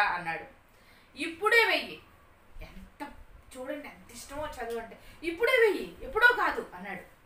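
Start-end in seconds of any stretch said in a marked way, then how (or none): 1.2-2.0 high, loud demand with falling end; acted childish insistence; insistent child. Boy demands school right now. 5.2-6.7 loud, high-pitched demand; acted stubborn demand, punched out; insistent child. Boy repeats demand to father.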